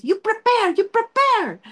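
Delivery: high, pressing "you prepare"; stern director. Pretend. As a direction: bear down on the actress to fire her up. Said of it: "acting-acting ako". (pinapagalitan ang aktres para umiyak)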